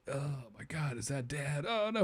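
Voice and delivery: small, stricken mock whimper; acted tearful shock. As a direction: play a child softly starting to cry. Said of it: "they start to cry about it".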